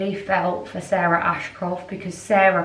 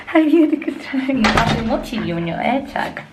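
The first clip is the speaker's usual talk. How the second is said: pet talk; 6 semitones higher, 4 dB louder than usual. Real, not acted. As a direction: coo up high like greeting a beloved pet. Cooing at her pet on return.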